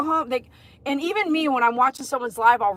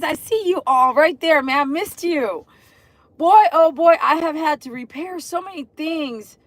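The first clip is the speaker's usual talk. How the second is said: ordinary delivery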